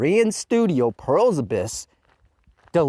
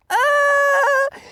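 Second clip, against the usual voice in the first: anguished wail; 7 dB louder than usual. Real, not acted. Anguished cry.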